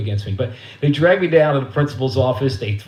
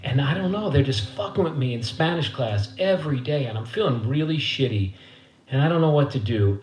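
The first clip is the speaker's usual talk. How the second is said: ordinary delivery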